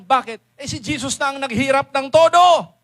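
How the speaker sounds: loud, rising preacher's cry; full-force sermon shout; preaching shout. Real, not acted. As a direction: drive it home to the crowd. Preacher building to a climax.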